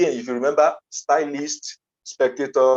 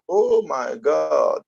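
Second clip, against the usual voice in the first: long drawn-out, higher exclamation; acted realisation; 5 semitones higher, much slower than usual. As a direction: stretch it out as a dawning realisation. Acts out his sudden realisation.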